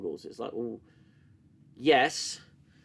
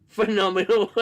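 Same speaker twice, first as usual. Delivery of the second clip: loud, laughing sarcasm; 6 semitones higher, 8 dB louder than usual. Real, not acted. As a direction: praise it while cracking up. Laughing at a still.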